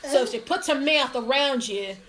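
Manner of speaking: broad, put-on Australian accent. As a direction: play a cheerful Aussie mate. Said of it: "I'll do my Australian accent".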